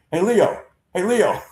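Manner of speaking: high, cheery repeated greeting; Jerry Coleman's "Hey Leo". Retold or imitated. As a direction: sing it out playfully, twice. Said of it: "he always called Randy his middle name".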